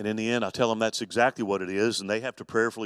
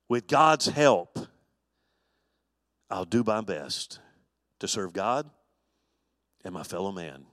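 slow reverent pledge; 4 semitones lower than usual. Shown as a demonstration. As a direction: say the oath slowly, as a prayer. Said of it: "I hope that's about right".

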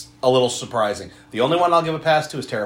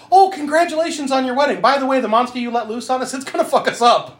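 high-pitched mock congratulations; imagined cheery villagers; 11 semitones higher, 4 dB louder than usual. Villagers congratulating the monster maker on his wedding, high mock-cheerful. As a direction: chirp it up high, playing oblivious townsfolk. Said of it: "Everyone's just like".

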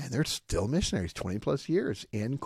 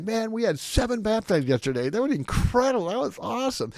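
much higher and louder, thrilled gushing; really excited; 13 semitones higher, 6 dB louder than usual. Retold or imitated. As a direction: burst with joy over the baptisms. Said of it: "He's really excited about his baptism".

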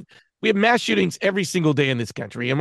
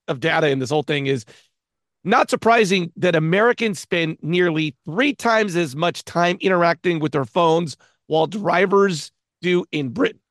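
usual speaking voice

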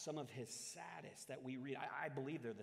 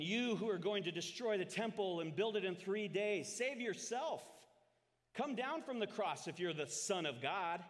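loud, higher-pitched jeering; hurled insults; 7 semitones higher, 8 dB louder than usual. A narrator's reading. Passers-by hurl insults at the crucified Jesus, loud jeering. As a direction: read it as crowd taunts, pitched up and loud. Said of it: "Those who passed by hurled insults at him".

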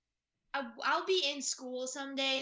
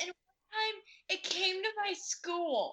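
laughing while telling it; giggly, excited retelling; 7 semitones higher, 8 dB louder, much slower than usual. Real, not acted.

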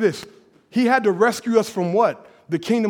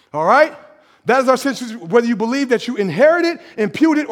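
ordinary delivery

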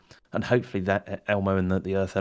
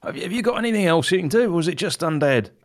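high, raised character voice; Griff shouting across; 7 semitones higher, 3 dB louder, faster than usual. Acted. Griff asks Turgeon about his spells, shouting across. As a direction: call over to an ally mid-fight. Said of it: "Griff will also shout to Turgeon".

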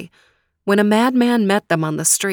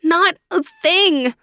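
slow, much higher, held-back grief; slow, pitched-up stifled sorrow; choking back grief; 11 semitones higher, 4 dB louder than usual. Acted. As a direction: fight back tears on the words. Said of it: "Faraz choked back his grief".

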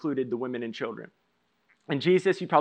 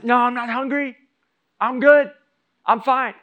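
mimicking wife; 7 semitones higher, 6 dB louder than usual. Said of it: "And Maddie's".

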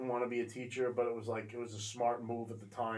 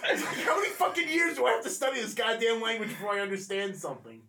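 exasperated rant; 8 semitones higher, 6 dB louder than usual. Retold or imitated. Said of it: "I'd be like".

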